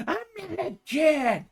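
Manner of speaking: angry shout. Acted. Rookie shouts back.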